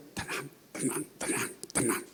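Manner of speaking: very soft, toneless whisper; calm someone down with no voice left; imitating a lost voice; voiceless reassurance. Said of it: "Suara hilang".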